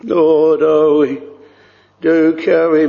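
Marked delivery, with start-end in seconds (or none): none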